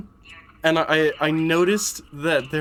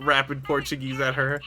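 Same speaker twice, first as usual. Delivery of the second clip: ordinary talk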